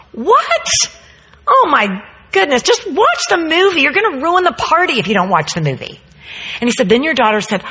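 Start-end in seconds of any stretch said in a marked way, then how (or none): 0.1-6.0 put-on high, pushy party girls; mock pleading girls, higher; urging girls. Guests urge Kylie to watch.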